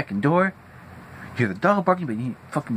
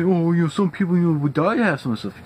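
higher, mocking patronizing relative; 6 semitones higher than usual. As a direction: sneer it like a smug relative. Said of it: "You say".

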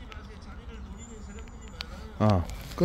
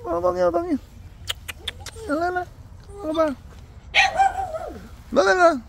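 high-pitched coaxing pet call; calling a dog. Real, not acted. Owner calling the dog Ogong to come over, high coaxing.

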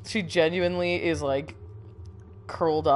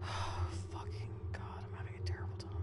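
whisper; 12 semitones lower, 17 dB quieter than usual. Real, not acted. Frightened player to herself, hushed whisper. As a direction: breathe it out in dread.

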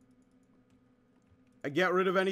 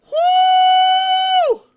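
very high whooping cheer; 13 dB louder than usual. Real, not acted. Gamer whooping after winning the fight. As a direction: let out a long joyous whoop.

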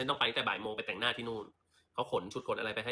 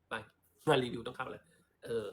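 normal talking voice

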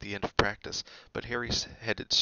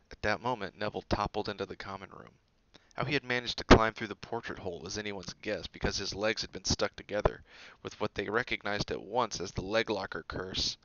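plain reading voice

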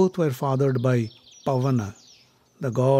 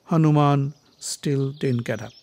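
plain reading voice

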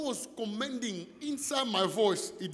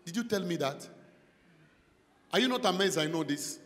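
normal talking voice

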